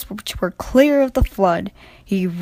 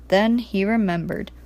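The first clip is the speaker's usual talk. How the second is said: plain reading voice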